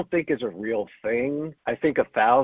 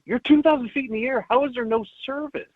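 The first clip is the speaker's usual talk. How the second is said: high-pitched comic disbelief; mock incredulity; 12 semitones higher than usual. Viewer talking back to the trailer, high incredulous voice. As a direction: question it in exasperated disbelief, pitched way up.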